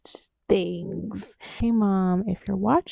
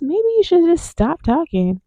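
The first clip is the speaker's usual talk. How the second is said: higher, pointed exasperation; exasperated; 8 semitones higher, 4 dB louder than usual. Real, not acted. Telling a chatty partner to stop talking, exasperated. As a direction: tell him off, fed up.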